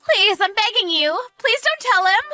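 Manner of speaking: very high, loud desperate plea; begging. Acted. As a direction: beg frantically at the top of your range. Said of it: "I didn't know you were able to talk like that".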